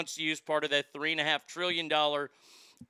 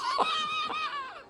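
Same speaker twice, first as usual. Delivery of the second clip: scoffing laugh. Real, not acted. Scoffing at the quote.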